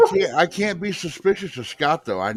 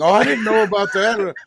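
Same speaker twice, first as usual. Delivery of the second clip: a higher, louder put-on apology; mock apologizing; 6 semitones higher, 4 dB louder than usual. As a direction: imitate someone apologizing in protest. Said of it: "He's in here in chat apologizing".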